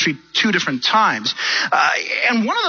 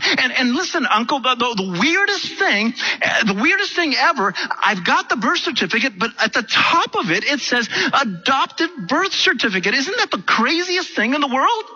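higher-pitched, imitating her amazement on the phone; amazed retelling; 6 semitones higher than usual. Woman telling her uncle about the birth certificate, acted amazement. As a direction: act out marvelling at the weirdest discovery.

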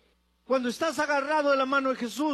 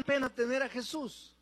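ordinary delivery

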